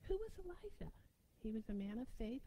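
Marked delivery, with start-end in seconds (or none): 0.1-0.9 breathy whispered question; hushed question to the church. Acted. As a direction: lean in and whisper it.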